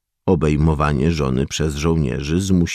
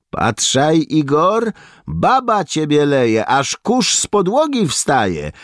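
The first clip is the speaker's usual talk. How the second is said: higher, louder mocking egging-on; goading taunt; 12 semitones higher, 4 dB louder than usual. A narrator's reading. Soldier egging on comrade beaten by woman, loud goading taunt. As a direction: needle him into a fight. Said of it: "podjudził go jeden z żołnierzy".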